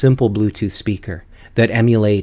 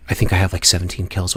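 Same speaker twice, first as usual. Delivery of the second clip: ordinary delivery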